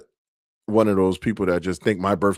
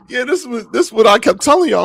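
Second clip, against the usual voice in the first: high, giggly told-you-so; laughing told-you-so; 5 dB louder than usual. Retold or imitated. Skater teasing sore-footed family, laughing high.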